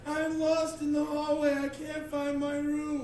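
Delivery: put-on high, loud kid's lament; lost little kid. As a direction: wail helplessly like a lost boy. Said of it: "So the kid is just like".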